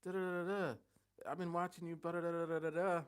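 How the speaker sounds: calling out like a pushy guy from New York; New York dude. Retold or imitated. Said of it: "And he's like".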